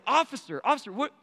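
high-pitched, stammered protest; alarmed appeal. Retold or imitated. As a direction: call out to the officer in rising alarm. Retold anxious appeal to officer.

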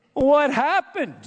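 high, urgent "What happened?" with a falling end; concerned buddy question. Pretend. Preacher asking Jonah what went wrong, high and concerned. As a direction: press a friend for an answer.

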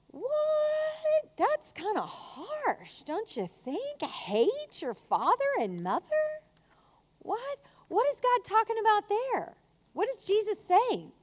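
high, swooping incredulous questions; mock shock. Preacher reacts to verse about hating parents, mock shock. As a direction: squeal the disbelief, stretched out and exaggerated.

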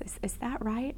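hushed unvoiced whisper; a friend whispering down the phone; whispered question. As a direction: whisper it as if afraid to ask. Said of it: "in whispered tones".

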